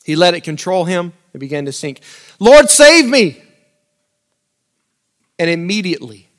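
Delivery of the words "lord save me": slow, louder, higher plea for rescue; desperate cry. Acted. Peter sinking in the waves calls to Jesus in a desperate cry.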